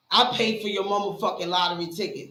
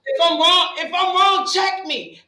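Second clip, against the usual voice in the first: raised voice; 6 semitones higher, 6 dB louder, slower than usual. Real, not acted. Heated point to friends.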